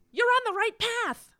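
high-pitched eager praise; gushing encouragement. Retold or imitated. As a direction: cheer her on brightly.

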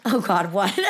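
real laugh breaking into the words; high, loud and laughing; nervous laughter. Real, not acted. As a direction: react through a startled laugh. Nervous laugh before co-host's confession.

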